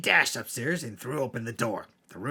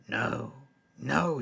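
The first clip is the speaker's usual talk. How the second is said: ordinary delivery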